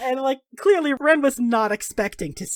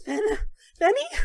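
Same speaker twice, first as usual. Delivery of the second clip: stunned recognition; 12 semitones higher than usual. Acted. Ren seeing his old dog, stunned and high. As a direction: voice jumps high, disbelieving.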